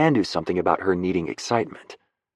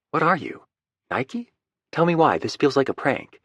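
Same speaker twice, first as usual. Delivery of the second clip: higher, suspicious Lucy teasing back; higher female voice, half laughing; Lucy's voice; 7 semitones higher than usual. Acted. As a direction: play the wary woman sensing a prank.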